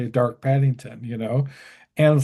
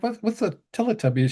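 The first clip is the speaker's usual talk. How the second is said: baffled question; 8 semitones higher, faster than usual. Retold or imitated. His own baffled reply.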